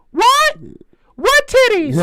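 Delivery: put-on very high, loud kid voice; little kid. Retelling the little kid's reply, high loud mock-child question. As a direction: squeal it as a baffled child. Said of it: "he go, she goes".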